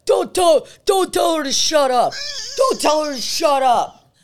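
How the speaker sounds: high, loud mock shouting; drunken yelling. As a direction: imitate a drunk yelling the same protest over and over. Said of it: "starts yelling at one of the girls".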